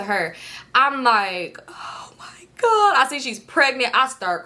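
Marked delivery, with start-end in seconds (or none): 1.7-2.9 slow, high, whispered shock; airy stretched-out gasp of dread; horrified whisper. Retold or imitated. Driver walking up to the hit car, horrified whisper.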